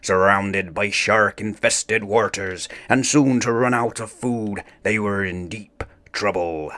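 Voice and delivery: ordinary delivery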